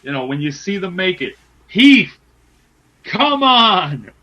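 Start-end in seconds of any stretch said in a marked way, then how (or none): 1.7-4.1 loud, high fan's cry; impassioned plea. Real, not acted. Fan begging for Heath to win, impassioned shout. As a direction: call out urgently for your wrestler. Pleading for favourite wrestler to win.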